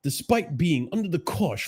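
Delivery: mock-English reading voice; English accent. Shown as a demonstration. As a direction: read it out in a put-on English accent. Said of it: "I should read this in an English accent".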